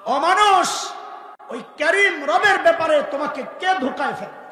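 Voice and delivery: high, loud call to mankind. Retold or imitated. Preacher voices God calling to mankind, loud and high. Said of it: "এই বলে বলে ডাকতে থাকেন".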